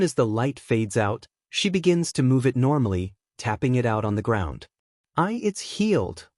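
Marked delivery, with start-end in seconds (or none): 5.2-6.3 high, slow, amazed gasp; amazed exclaim. A narrator's reading. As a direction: marvel at the healing. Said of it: "she exclaims".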